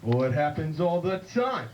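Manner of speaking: John Wayne. Acted. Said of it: "John Wayne comes back".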